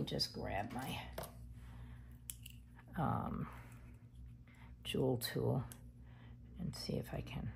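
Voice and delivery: breathy whisper while focusing. Real, not acted. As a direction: whisper it under your breath.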